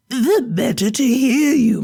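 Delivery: put-on a wolf faking a sweet old woman; wolf as grandmother.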